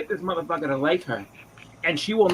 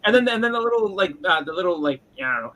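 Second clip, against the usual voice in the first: ordinary talk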